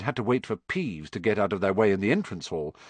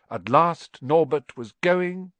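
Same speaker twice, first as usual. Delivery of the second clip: slow; 7 semitones higher, 4 dB louder, slower than usual. A narrator's reading. Narration savouring departure.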